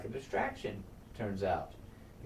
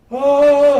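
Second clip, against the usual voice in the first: very high, loud cry of alarm; alarmed shout; 17 dB louder than usual. Retold or imitated. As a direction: scream it as riders appear below. Guard on wall spotting attackers.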